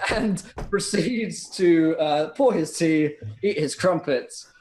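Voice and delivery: ordinary delivery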